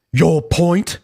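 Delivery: put-on British comeback; terse mock British reply. Host reading Buckby's repeated retort, British voice. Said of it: "read Buckby's voice in a British voice".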